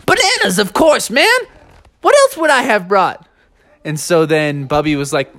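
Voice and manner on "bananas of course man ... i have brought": high, excited character voice; Monk Master. Acted. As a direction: pipe it up high, as if it's obvious. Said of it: "Monk Master said".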